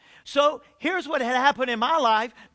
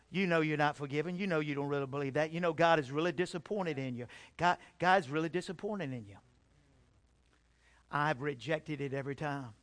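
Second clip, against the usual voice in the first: soft, low taunting thoughts; hushed inner accusation; 7 semitones lower, 8 dB quieter than usual. Retold or imitated. Preacher voicing the devil's accusations to the congregation, hushed. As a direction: drop the voice like a whispering doubt.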